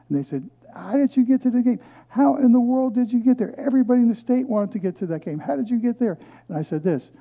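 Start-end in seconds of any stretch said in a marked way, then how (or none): 0.7-6.2 high, loud, amazed questions; amazed crowd firing questions, high and loud; astonished. Retold or imitated. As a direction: pile on the disbelief, higher and louder. Audience voiced, astonished questions.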